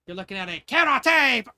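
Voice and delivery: put-on a girl bragging to her friend's father, shouting; boastful shout. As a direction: yell it like a proud fighter showing off. Belle bragging about her fighting.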